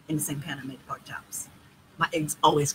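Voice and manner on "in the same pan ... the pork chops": whisper. Real, not acted. Conspiratorial aside about pork pan.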